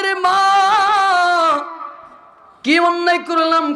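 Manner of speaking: high, chanted tearful plea; tearful call. Retold or imitated. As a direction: call out in a sung lament, imitating a weeping father. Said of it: "কান্না জড়িত কণ্ঠে ডাক দিয়ে বলে". (কান্নাজড়িত সুরেলা ডাক)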